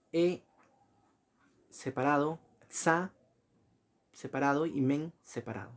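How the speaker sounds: "E", "xa", "men" each isolated, with long pauses; syllable-by-syllable dictation. Shown as a demonstration. Teacher dividing examen for students, "E", "xa", "men" spaced out.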